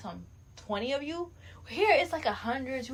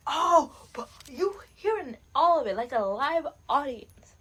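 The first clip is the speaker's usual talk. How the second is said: mock partygoer; 5 semitones higher, 9 dB louder than usual. Mocking people at a watch party.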